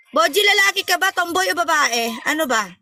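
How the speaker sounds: usual speaking voice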